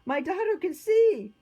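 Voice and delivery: high, loud amazed shout; elated, ringing high voice; joyful exclamation. A narrator's reading. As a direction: cry out the wonderful news. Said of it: "Marcus exclaimed".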